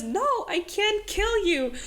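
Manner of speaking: mock Oro pleading. Oro voiced in the retelling.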